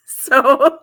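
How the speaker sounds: bursts of real laughter; laughing. Real, not acted. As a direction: break up laughing. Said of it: "as soon as I read this".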